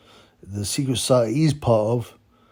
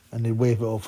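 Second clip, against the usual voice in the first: ordinary talk, nothing marked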